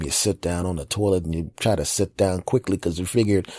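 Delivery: normal talking voice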